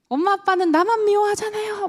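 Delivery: put-on high whiny child's complaint; sulky child. Child complaining to parents in a high sulky whine. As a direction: whine it like a hurt kid. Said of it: "막 이렇게 얘기를 해요".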